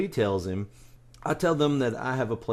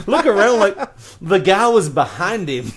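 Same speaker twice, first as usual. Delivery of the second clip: usual speaking voice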